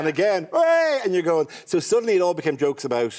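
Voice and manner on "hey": mock cheer. Irish crowd cheering a name.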